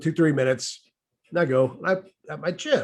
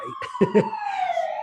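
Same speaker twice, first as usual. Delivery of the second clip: high squeaky laugh; real laugh; 4 dB quieter than usual. Real, not acted. Warning about gun states, squeaky amused laugh. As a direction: laugh at the dark joke.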